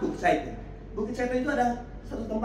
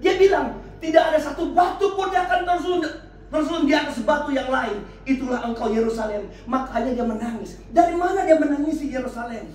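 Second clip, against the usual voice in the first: raised, insistent sermon voice swelling to shouts; impassioned preaching; 7 semitones higher, 4 dB louder than usual. Real, not acted. Preacher urging congregation to bring struggles to God, impassioned preaching. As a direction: drive it home to the congregation, phrase by phrase.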